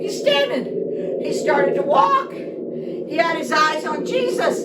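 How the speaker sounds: high, loud, wondering telling; excited narration. A narrator's reading. To the children, amazed at Peter walking. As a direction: marvel out loud as it happens.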